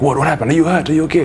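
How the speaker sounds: alarmed man. Retold or imitated.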